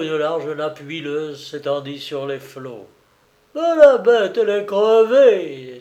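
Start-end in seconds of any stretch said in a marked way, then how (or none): 3.5-5.8 high, loud triumphant outburst; exclaiming. A narrator's reading. Old sailor seeing the submarine sink, triumphant exclamation. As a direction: burst out over the sunk beast. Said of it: "s'exclama Saloon".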